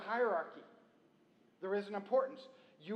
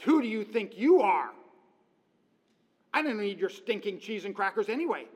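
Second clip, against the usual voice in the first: indignant comeback; 3 semitones higher, 11 dB louder than usual. Retold or imitated. Preacher voicing an offended woman, loud and indignant. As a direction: snap back right in their face. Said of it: "get right in their face and come back with".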